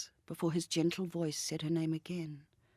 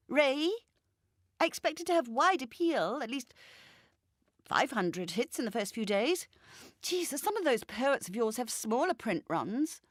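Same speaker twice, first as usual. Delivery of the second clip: higher, louder insistent woman's voice; Jacinta pleading; 7 semitones higher, 4 dB louder than usual. Acted. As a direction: hold her back, talking over the phone. Jacinta boasting about her novel.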